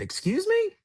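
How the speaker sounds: sharp high-pitched excuse me; shocked he got the job; stunned disbelief. Retold or imitated. Hearing he's tour manager, replies in high disbelief.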